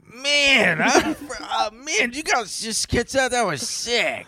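high-pitched snowboarder dude; stoked mock board-sport bro.

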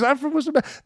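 very high-pitched mock-Boston exclamation; bad Boston accent. Soldier discovers shared hometown, hammed-up bad Boston accent. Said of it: "my accent sucks".

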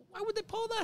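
real surprise in a high voice; incredulous. Real, not acted. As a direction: squeak out your disbelief. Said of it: "incredulous reaction".

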